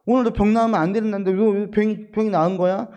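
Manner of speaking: mock the nitpicking accuser, loud and pointed; indignant demand. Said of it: "이렇게 따지는 거예요".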